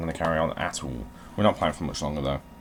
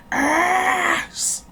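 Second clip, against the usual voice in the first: shriek; 7 dB louder than usual. Real, not acted. Sudden outburst at game.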